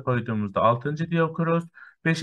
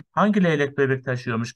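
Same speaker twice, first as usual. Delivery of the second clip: ordinary talk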